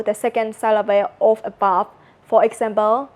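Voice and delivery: ordinary talk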